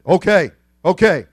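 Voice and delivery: crying out. Retold or imitated. Tyson in prison giving in to inmates, loud cry. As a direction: beg them to stop. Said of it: "he cried out".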